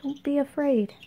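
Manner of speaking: ordinary delivery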